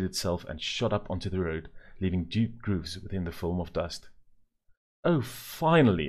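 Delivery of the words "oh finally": high, loud and drawn-out relief; relieved exclamation. A narrator's reading. Mother cheering as the stuck car finally frees, relieved exclaim. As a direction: let the relief burst out slowly. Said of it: "exclaimed Mother".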